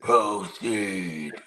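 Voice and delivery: echoing the cave's slow command; a hint of the booming cave; Cave of Wonders voice. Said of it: "They used that same voice".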